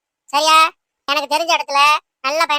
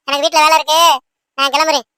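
usual speaking voice

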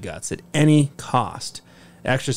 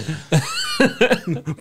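shrieking laugh; high laugh. Real, not acted.

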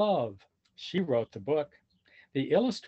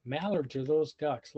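ordinary delivery

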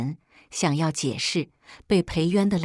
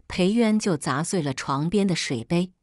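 usual speaking voice

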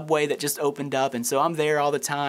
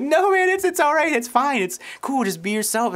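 eager reassurance; 9 semitones higher than usual. Retold or imitated. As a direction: rush to put him at ease, pitched up. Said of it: "I'm like".

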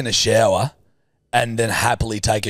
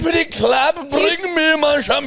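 mock diva. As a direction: play the pampered snob calling for shampoo. Mock spoiled diva demand.

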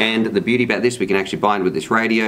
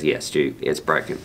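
low, gruff radio announcer; deep New Zealander voice; 8 semitones lower, 5 dB quieter than usual. Retold or imitated. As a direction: lightly mimic a burly Kiwi dude. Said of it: "like the sound pack guy says".

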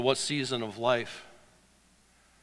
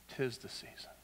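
'Tis the season whispered softly; 10 dB quieter than usual. Shown as a demonstration. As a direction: breathe the phrase as an echo. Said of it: "'Tis the season".

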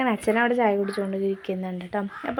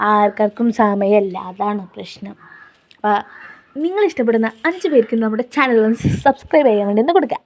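ordinary talk, nothing marked

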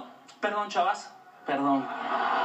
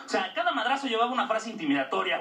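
usual speaking voice

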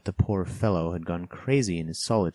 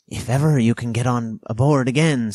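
higher, louder, perking up slyly; startled slyness; 6 semitones higher, 9 dB louder than usual. A narrator's reading. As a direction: perk up suddenly, loud and sly. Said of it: "perked up into a kind of startled slyness".

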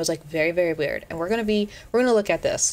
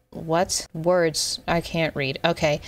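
ordinary talk, nothing marked

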